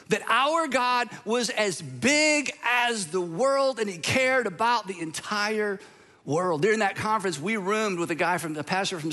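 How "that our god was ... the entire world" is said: preaching climax. Real, not acted. Sermon climax over music.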